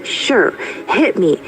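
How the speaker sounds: put-on high, bold little boy; fearless child. A narrator's reading. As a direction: dare him, chin up.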